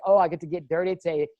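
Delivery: bubbling over to get started; acted excitement. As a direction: act out eager anticipation, voice rising. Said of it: "It's the excitement of knowing".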